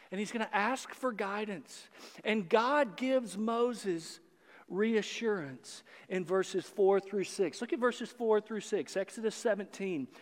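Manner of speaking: usual speaking voice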